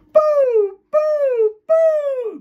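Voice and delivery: very high, drawn-out falsetto poo; whooshing delete noise. Shown as a demonstration. Teacher deleting someone from the left brain, falsetto whoops. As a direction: shoot each poo out like a siren.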